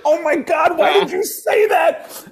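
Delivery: very high, squealed mock horror; horrified panic. Comic reacts to buddy's answer to cop, squealing horror. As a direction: squeal it, appalled at your friend.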